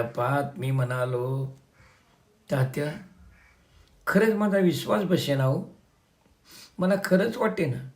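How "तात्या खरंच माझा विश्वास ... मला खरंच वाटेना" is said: higher, astonished pleading; can't believe it, voice lifting; disbelief. A narrator's reading. Son tells Tatya he cannot believe it, stunned.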